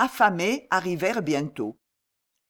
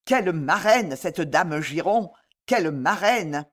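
plain reading voice

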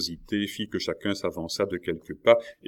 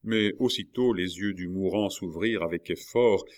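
usual speaking voice

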